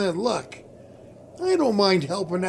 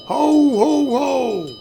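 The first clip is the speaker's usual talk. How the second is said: a lightly raised, hearty Santa ho ho; slightly higher, louder Santa greeting; jolly Santa laugh; 5 semitones higher, 5 dB louder, slower than usual. Acted. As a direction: boom it out like a department-store Santa. [name] playing Santa on street.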